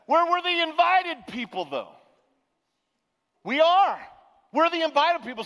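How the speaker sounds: loud, high, emphatic sermon delivery; preaching at full force. Real, not acted. Pastor urging the congregation, loud and high preaching. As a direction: proclaim it to the whole church.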